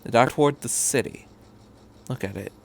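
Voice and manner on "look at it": much softer, lower; soft smiling. Acted. Doctor showing the boy the city, softly smiling. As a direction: say it with a smile. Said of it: "he smiled".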